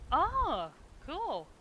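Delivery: a bright, real jolt of surprise; surprised. Real, not acted.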